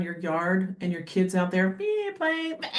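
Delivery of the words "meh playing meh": put-on high, nasal-free whiny "meh" like a fretting child; mock whiny kid. Imitating a whiny kid to her podcast co-host. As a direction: mimic a whining kid, pitched way up. Mocking kids playing in yard.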